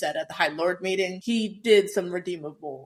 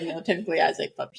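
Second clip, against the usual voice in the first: ordinary talk, nothing marked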